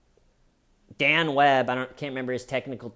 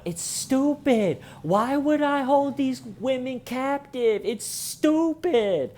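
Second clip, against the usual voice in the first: much higher, slow exaggerated scoff; mock dismissive outburst; 14 semitones higher, 4 dB louder, slower than usual. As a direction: sneer it in a squeaky parody voice. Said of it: "he starts going".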